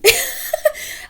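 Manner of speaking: breathless squeaky laugh burst; high unvoiced laugh. Real, not acted. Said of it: "I'm laughing".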